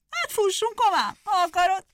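high, loud hare voice; eager hare. Acted. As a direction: egg him on to run.

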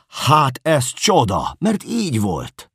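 high, loud and slow cry of joy; crying out gladly at the answer; joyful exclaim. Acted. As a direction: shout it out in delight. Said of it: "örvendezve kiáltottam fel".

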